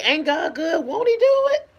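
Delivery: bright, high praise, a little lifted; upbeat exclamation. Retold or imitated. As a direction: say it like everyday praise. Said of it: "I say".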